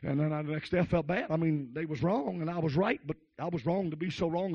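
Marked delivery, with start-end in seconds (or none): none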